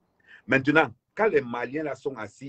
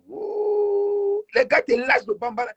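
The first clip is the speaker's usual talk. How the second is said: startled exclamation; 4 dB louder, slower than usual. Retold or imitated. Mimicking a startled passenger.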